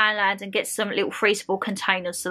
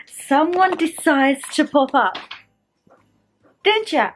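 talking to the dog; 5 semitones higher, 4 dB louder, slower than usual. Real, not acted. Cooing at the dog.

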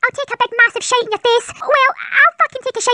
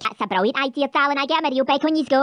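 deep, growling Scottish mum; gruff low mother voice; 11 semitones lower, 6 dB quieter than usual. Acted.

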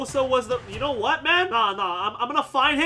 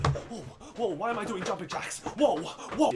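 ordinary talk, nothing marked

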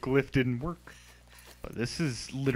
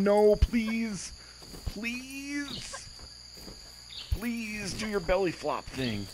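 higher, drawn-out begging to the character; coaxing the game character to do her belly flop, higher and pleading; mock pleading; 10 semitones higher, much slower than usual. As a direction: plead with her, half joking.

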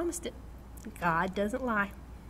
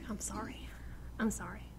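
soft apology; 12 dB quieter than usual. Retold or imitated. Apologizing to the Lord.

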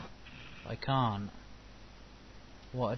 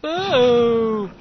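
loud playful boo; 9 semitones higher, 12 dB louder than usual. Pretend. Mock scaring an enemy.

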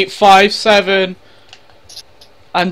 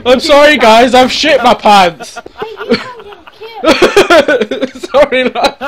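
helpless laughter, pitched up and loud; laughing hard; 6 semitones higher, 4 dB louder than usual. Real, not acted. Friend cracking up at the shit-pants joke, laughing. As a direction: say it through a fit of laughter.

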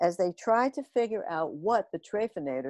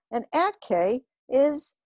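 slow, higher alien complaint; backwards alien yell; 7 semitones higher, slower than usual. A narrator's reading. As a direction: wail it slowly and backwards. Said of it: "yelled the trefinator".

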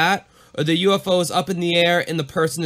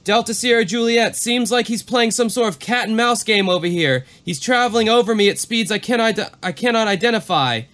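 higher, pressing radio voice; uneasy pilot; 7 semitones higher than usual. Retold or imitated. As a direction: report it with growing alarm. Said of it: "Back to Valentik".

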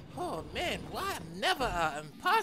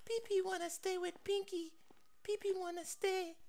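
squeaky pet voice; 8 semitones higher, 6 dB quieter than usual. Acted. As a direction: talk like a tiny pet. Pet begs to stay.

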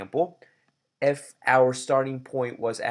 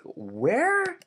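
mock surprise; 13 semitones higher, 4 dB louder than usual. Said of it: "He's like".

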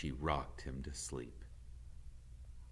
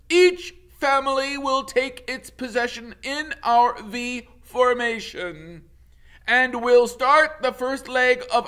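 booming goose leader announcement; commanding goose leader; 16 dB louder than usual. Acted. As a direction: address the whole flock like a proud leader. Leader goose addresses the flock.

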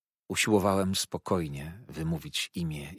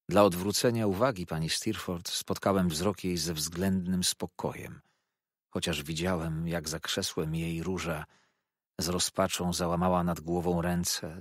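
ordinary delivery, nothing marked

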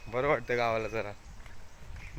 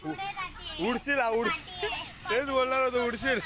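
warning call; 13 semitones higher than usual. Real, not acted. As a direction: warn her she'll fall. Warning a child she'll fall. (पडशील म्हणून उंच आवाजात इशारा)

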